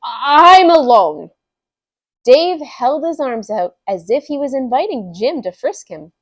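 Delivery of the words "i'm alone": loud, drawn-out declaration; awkward protest. Acted. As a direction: stretch it out slowly and loudly. Awkward explanation to neighbor.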